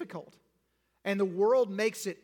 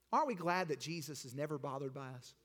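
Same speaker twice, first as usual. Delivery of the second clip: ordinary talk, nothing marked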